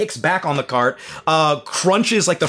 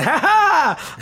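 mock laugh; 14 semitones higher than usual. Strange person laughing.

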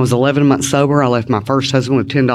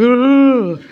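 high, loud groan of dread; exasperated ugh; 11 semitones higher, 3 dB louder than usual. Retold or imitated. Hearing "try again tomorrow", a high loud mock groan. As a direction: groan like you can't face it. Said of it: "It's like".

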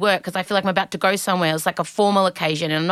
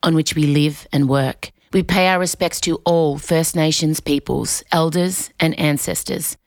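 measured, solemn formal read; formal acknowledgment; 3 semitones lower than usual. Real, not acted. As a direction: read a respectful statement, evenly and deliberately. Reads an acknowledgment of country.

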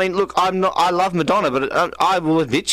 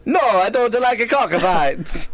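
put-on Italian accent; 7 semitones higher, slower than usual. Redoing the line for a friend in mock Italian accent. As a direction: shout "No" and drawl "cockfight" Italian-style. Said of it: "the Italian accent".

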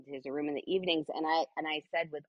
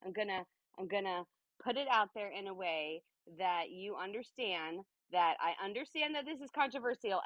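normal talking voice

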